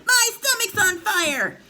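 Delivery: high, slow, loud wail; panicked shout. A narrator's reading. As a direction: shriek it out in agony. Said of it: "she suddenly shouted".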